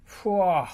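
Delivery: high, loud cat yowl; cross cat yowl. Acted. Pilchard yowling crossly when the tyre hits her. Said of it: "she yowled crossly".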